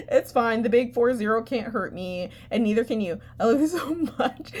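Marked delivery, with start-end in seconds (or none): 3.4-4.5 laughing fondly. Real, not acted. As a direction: say it through a laugh. Laughs through sign-off.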